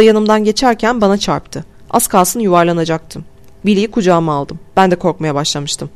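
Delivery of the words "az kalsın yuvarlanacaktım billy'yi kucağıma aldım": ordinary delivery, nothing marked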